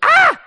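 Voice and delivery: piercing, very high shriek; toddler's scream. Retold or imitated. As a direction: imitate a screaming toddler, loud and sudden. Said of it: "this is how he starts screaming at me".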